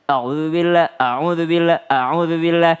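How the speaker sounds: A'UDZUBILLAH run together with short vowels; rushed. Shown as a demonstration. Teacher comparing a hurried a'udzubillah, rushed. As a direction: gabble A'UDZUBILLAH, clipping the long U. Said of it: "dipercepat ya".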